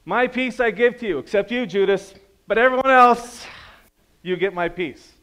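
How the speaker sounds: high, loud, sarcastic twist of the verse; mock misquote. Preacher mocking a misreading of Jesus' words, loud and high. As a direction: ham up a petty Jesus who leaves Judas out. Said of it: "Is that what the Bible says?".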